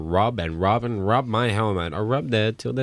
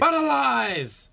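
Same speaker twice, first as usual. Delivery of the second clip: wailing punchline; slower than usual. Acted. Repeats punchline dramatically.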